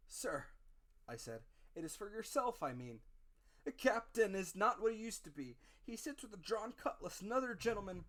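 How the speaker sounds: put-on loud, high frightened boy; frightened pleading. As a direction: plead in panic.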